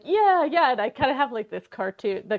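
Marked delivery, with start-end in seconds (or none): 0.0-0.7 high, loud eager agreement; eager rats. Retold or imitated. Retelling the fable, the rats cheer the plan in an eager high voice. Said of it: "And the rats are all like".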